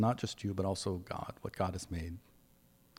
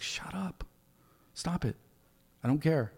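exasperated snap; 5 semitones higher, 4 dB quieter than usual. Retold or imitated. Annoyed reply to repeated apologies.

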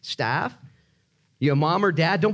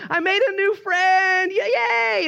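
very high, slow, sing-out cheer; mock elation; 4 dB louder, much slower than usual. Preacher acting someone with a new friend, hammed-up elation. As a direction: squeal it with exaggerated glee.

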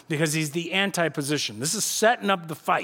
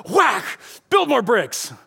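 loud, higher, abrupt order; sudden harsh command; 6 semitones higher, 4 dB louder, slower than usual. Acted. Pharaoh breaking up Israel's worship, loud abrupt command.

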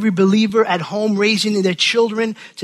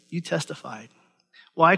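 usual speaking voice

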